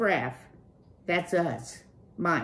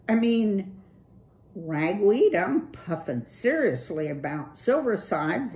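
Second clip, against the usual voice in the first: higher, loose slacker-dude voice; Clutch, cool dude; 6 semitones higher, 5 dB louder than usual. Acted. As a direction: play the laid-back streetwise rocker.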